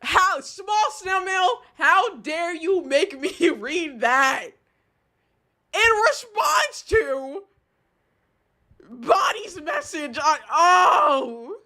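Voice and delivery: slow, high, emphatic scolding; indignant outrage. Real, not acted. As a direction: draw out each word in outrage, ending in a loud groan. Indignantly scolds chat for the message.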